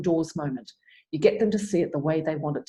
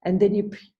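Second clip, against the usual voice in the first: ordinary talk, nothing marked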